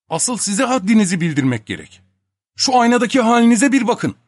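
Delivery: high retort building to loud; angry scolding. A narrator's reading. Scolding Topak over the state he is in, angry. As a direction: put him firmly in his place.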